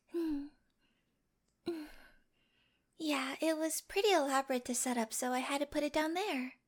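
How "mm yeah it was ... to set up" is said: ordinary talk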